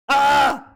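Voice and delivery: loud, high exasperated "ah"; frustrated scream. Real, not acted. Fan sick of repetitive sword fights, frustrated scream. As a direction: let out a burst of frustration.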